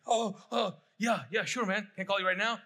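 high, loud, over-eager reply; hammed-up jumpy eagerness; mock-anxious eagerness. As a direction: act out a flustered parent. Acts out anxious texting with son.

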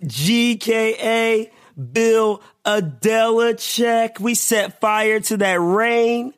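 high, stretched-out alias proclamation; announcer-style roll call. Acted. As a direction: declaim the pun up high, slowly. Performs punning nickname roll call.

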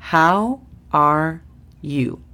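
"How are you?" en inglés, lento y claro; English model. Shown as a demonstration. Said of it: "La pronunciación fonética". (modelo de pronunciación en inglés)

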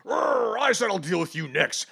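growled angry hero voice, high and loud; hammed-up angry Superman growl; acting out Superman. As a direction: snarl it like a furious superhero. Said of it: "he's like".

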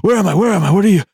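high, frantic, half-awake panic; jolted from sleep, no idea where; dazed and confused. Retold or imitated. Trucker jolted awake, disoriented.

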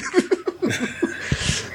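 airy unvoiced giggle, high; breathy laugh. Real, not acted. Amused at the made-up name, soft airy laugh. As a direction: let a breathy laugh slip out.

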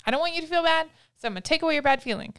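a little high, rushed fix-it voice; fixing-it parent. Retold or imitated. Parent rushing to erase the child's upset, quick eager voice. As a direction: hurry to take the bad feeling away. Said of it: "sometimes that feels like".

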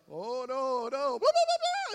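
loud protest climbing into shrieked babble; mock panic. Student refusing dentist chair, hammed-up rising panic. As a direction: build from "oh no" to high gibberish.

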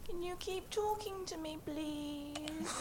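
soft pleading call; scared. Acted. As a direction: ask nervously from the dark. Calling back scared from outside.

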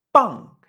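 "pan" high and loud, no air on p, falling; unaspirated B. Shown as a demonstration. Teacher repeating the B version for learners, emphatic unaspirated demonstration. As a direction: hit "pan" without a puff, ending in ng. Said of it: "Con B es".

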